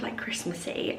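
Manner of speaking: soft whispered aside; whispering. Real, not acted. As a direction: breathe it out as a secret.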